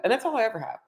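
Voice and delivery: ordinary delivery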